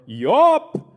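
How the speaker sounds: high, loud call sent out across a valley; yelled call. Shown as a demonstration. Hiker on a mountain trail calling out to hear the echo, loud yell. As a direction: throw the call at the far ridge. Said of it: "compelled to yell out".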